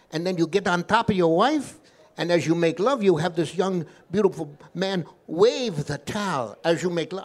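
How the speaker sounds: put-on lilting Yiddish-accented instructions. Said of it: "This is my Yiddish accent".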